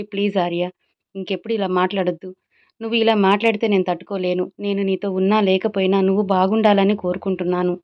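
plain reading voice

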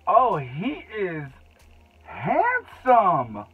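real smitten praise; swooning. Real, not acted. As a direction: drool over it like a crush. Swooning over the product.

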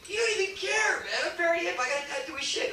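high put-on hipster voice; hip character voice. Emcee showing off characters to the audience, high cocky voice. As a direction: pitch it way up as a cocky hip dude. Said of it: "a lot of characters in one fell swoop".

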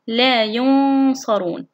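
long nasal hum for the noon in ينصرون; ikhfa demonstration. Shown as a demonstration. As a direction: draw out ينصرون slowly, hiding the noon. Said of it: "كيف لي أن أنطقه؟ أقول". (إخفاء النون بغنّة ممدودة في ينصرون)

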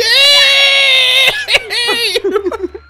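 loud, high screaming laughter; shrieking laugh. Real, not acted. Gamer celebrating the jump, shrieking laugh.

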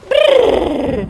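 high, loud lip-trill brrr. Acted. Wife making a loud shivering brrr.

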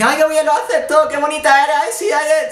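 aguda, empalagosa de madre aduladora; voz de pelota aduladora. Retold or imitated. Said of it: "es cuando alguien te dice". (voz de madre pelota)

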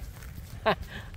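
brief breathy chuckle; short laugh. Real, not acted. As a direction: let out a small laugh. Amused at the animal. (cười khẽ)

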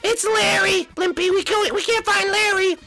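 very high worried voice; squeaky and anxious; Toad voice. Acted.

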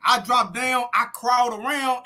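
ordinary talk

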